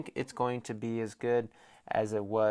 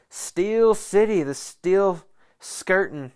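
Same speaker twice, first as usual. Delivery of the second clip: mocking nicknames; 6 semitones higher, 8 dB louder, slower than usual. Real, not acted. Mocking nicknames for rival Steelers.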